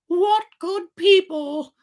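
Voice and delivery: very high, slow puppet voice full of wonder; high puppet voice, admiring. A narrator's reading. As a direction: marvel at them like a trusting child. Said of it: "thought Pinocchio to himself".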